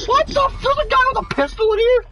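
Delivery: high surprised yelp; startled. Real, not acted. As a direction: caught off guard by an enemy. Sudden enemy in building.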